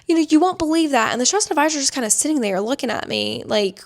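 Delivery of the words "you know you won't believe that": higher, louder whiny boss impression; mocking the boss. Boss complaining to advisor, mock whiny. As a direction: tattle like an outraged boss. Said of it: "And she's like".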